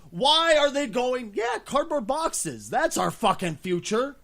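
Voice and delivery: loud, slow incredulous mockery; drawn-out outraged disbelief. Real, not acted. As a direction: ridicule the cardboard idea. Mocking Nintendo's pitch.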